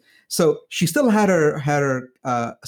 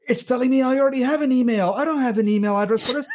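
flustered quote; 9 semitones higher, faster than usual. Retold or imitated. Woman confused by login.

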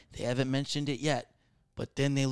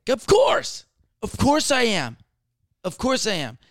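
high, loud burst easing down a little; over-eager customer-service cheer; fake eagerness; 9 semitones higher, 6 dB louder, slower than usual. Server answering a request for free chips, gushing fake enthusiasm.

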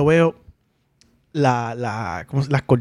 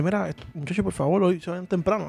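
a neighbor's request, said much softer; hushed neighbor; 2 semitones higher, 8 dB quieter than usual. Retold or imitated. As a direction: neighbor asking the boys to go home early, quietly. Neighbor's request voiced in story.

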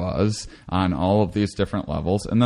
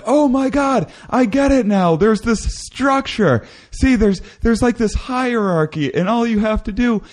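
much higher, wide-eyed true believer gushing about the hierarchy; awed convert; 13 semitones higher than usual. Pretend. Imagining a DMT dweller tripping on our reality, high, giddy, awestruck. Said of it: "and be like".

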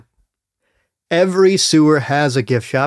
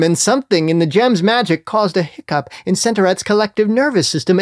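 ordinary delivery, nothing marked

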